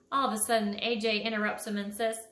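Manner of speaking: ordinary delivery, nothing marked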